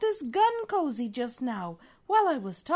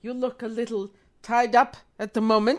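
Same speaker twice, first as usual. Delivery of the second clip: put-on failed German accent; 4 semitones lower, 6 dB louder than usual. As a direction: lower villain voice, barely German. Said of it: "That's not German".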